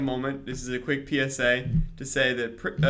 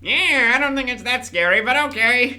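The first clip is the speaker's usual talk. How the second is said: high, loud cartoon villain voice; loud, high-pitched villain put-on; Skeletor; 8 semitones higher, 5 dB louder than usual. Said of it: "I'll do my Skeletor voice".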